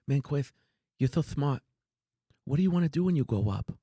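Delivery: high, fast mock Tyson voice; lisping high Tyson imitation, quick; playful high-voiced boxer impression; Mike Tyson impression. Tyson voice quoted in story.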